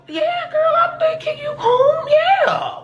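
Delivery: very high, chipper reassurance; overly sweet squeak; comic falsetto reassuring girl. Retold or imitated. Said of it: "But I'm like".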